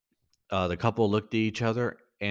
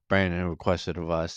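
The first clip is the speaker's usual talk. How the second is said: ordinary delivery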